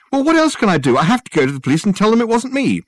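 high, loud pleading; desperate. A narrator's reading. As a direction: argue in desperation. Richard desperate argument.

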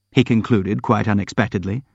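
plain reading voice

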